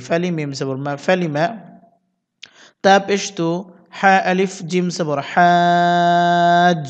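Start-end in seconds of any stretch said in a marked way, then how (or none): 4.0-6.8 হাজ with the vowel held very long; slow drawn হাজ; four-alif stretch. Shown as a demonstration. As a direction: hold the a for four counts, then close on jim. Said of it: "চার আলিফ টেনে তারপর জিমকে ধরতে হবে".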